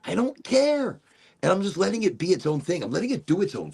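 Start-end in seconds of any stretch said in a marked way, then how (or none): 0.1-1.0 "care" pushed high, then falling; insist, swooping up high on "care"; emphatic dismissal. Real, not acted. Repeats it with mounting emphasis.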